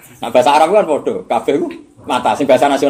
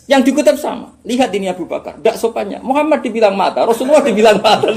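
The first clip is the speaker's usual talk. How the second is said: mock outrage, high-pitched and raised; intonasi menghasut; 9 semitones higher, 5 dB louder than usual. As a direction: imitate an accuser stirring up the crowd, loudly. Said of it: "tapi intonasinya ndak seperti itu". (meniru penghasut, lantang bernada tinggi)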